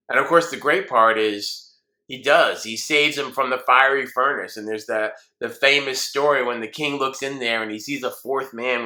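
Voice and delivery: ordinary talk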